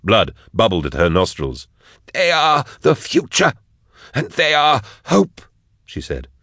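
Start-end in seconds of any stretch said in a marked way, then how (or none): 2.1-5.4 high, strained proclamation; fervent captive. A narrator's reading. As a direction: declare it fervently, pitched high. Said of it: "Jaff snorted, amused".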